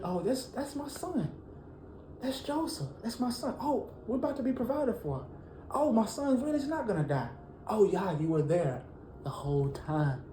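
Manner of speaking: hushed amazement at his son alive; Jacob's joyful realization. Acted. Jacob realizing his son Joseph lives, awed and hushed.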